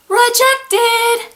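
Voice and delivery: high sing-song announcement; very high, chanted exclamation; mock disbelief. Real, not acted. Mock outrage at famous author rejected.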